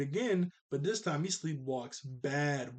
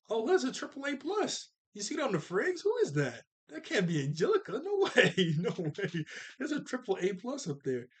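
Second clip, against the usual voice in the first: high, gushing brag; hammed-up delighted Angelica; gushing kid; 7 semitones higher than usual. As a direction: show off the grade in delight. Angelica gushing over grade.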